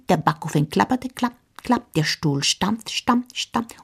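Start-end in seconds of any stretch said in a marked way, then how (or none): none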